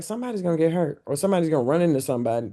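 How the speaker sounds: fast, worried rush; worried teammates. Retold or imitated. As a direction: blurt the fear quickly. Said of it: "everybody was kinda like".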